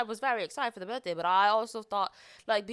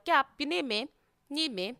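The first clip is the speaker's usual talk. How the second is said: casual British accent; 6 semitones higher, 4 dB louder than usual. Shown as a demonstration. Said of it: "just speaking like it".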